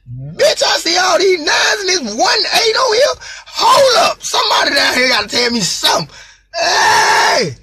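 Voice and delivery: very high, loud frantic yelling; yelling down the vent for someone to explain; panicked shout. Retold or imitated. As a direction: demand an answer in a panic.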